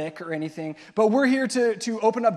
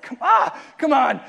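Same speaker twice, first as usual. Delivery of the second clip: high, loud taunting call; 6 semitones higher, 7 dB louder than usual. Retold or imitated. Staff on the roofs taunting the cabins, loud mock call. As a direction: yell to lure them out. Calling out to scare campers.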